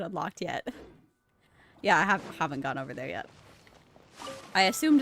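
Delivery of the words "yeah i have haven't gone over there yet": normal talking voice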